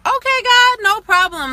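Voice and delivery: promising God instantly, high and loud; eager yes to God. Retold or imitated. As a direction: jump at it like a keen recruit.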